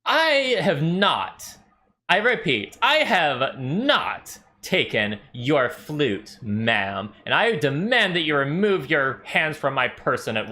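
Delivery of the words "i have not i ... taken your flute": slow, high, each word pressed; indignant denial. Acted. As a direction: spell it out with offended dignity. Halfling indignantly denying theft.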